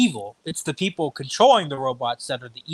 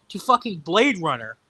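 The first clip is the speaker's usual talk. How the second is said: usual speaking voice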